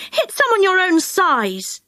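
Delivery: high scolding retort; indignant cry. Acted. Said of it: "cried Jo".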